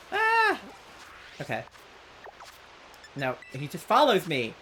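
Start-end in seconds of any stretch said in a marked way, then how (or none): 0.1-0.6 sudden high squeal; high yelp. Real, not acted. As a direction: squeal in frustration. Follower catches up.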